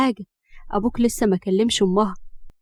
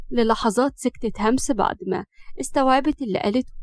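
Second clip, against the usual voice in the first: plain reading voice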